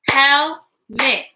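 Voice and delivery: HELMET clapped out as two separate beats; broken into syllables. Shown as a demonstration. Teacher asking children to clap along, Helmet split in two. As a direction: say Helmet in two parts, pausing between them. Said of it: "Break words into parts".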